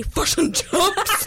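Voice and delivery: "fish and chips" very slow, high, as "fush and chups"; comic broad New Zealand accent; amped-up New Zealand accent. Acted. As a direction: drag out hugely exaggerated Kiwi vowels. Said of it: "I amp up my New Zealand accent".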